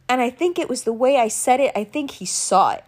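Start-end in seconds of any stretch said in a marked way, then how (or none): none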